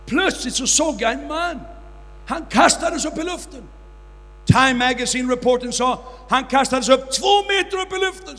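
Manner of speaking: loud, rising preacher's storytelling; fiery preaching. Acted. Preacher recounting the miracle to his audience, fiery and loud. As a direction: drive the miracle story home to the crowd.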